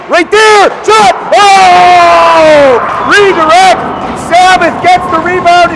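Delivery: high, loud play-by-play peaking in a long shouted "Oh!"; excited goal call. Real, not acted. Announcer calling Pierce's shot and the rebound goal, thrilled.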